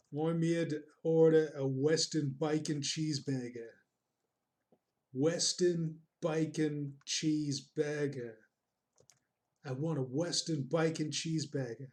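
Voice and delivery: hammed-up Australian burger order; Australian accent. Shown as a demonstration. Customer ordering a Western bacon cheeseburger, Australian accent. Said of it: "The only time I practice my Australian accent".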